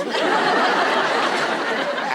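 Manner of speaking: long high laugh; real helpless laugh; high cackling laugh. Real, not acted. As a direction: break up laughing. Laughing at own story.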